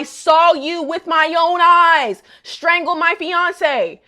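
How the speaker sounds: loud, slow, indignant; outraged. Retold or imitated. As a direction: spell out the outrage slowly. Outraged retelling of Kudo.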